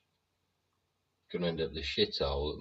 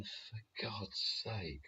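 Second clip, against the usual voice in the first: exasperated groan; 5 semitones higher, 8 dB quieter, much slower than usual. Retold or imitated. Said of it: "And you're going".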